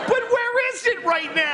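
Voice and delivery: high-pitched shouted demand; mock outrage. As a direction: cry out like an entitled, outraged guest. Mock entitled demand for laundry.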